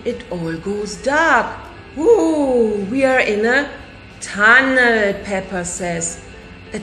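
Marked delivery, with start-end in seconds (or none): none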